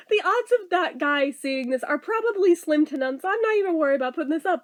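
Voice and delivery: usual speaking voice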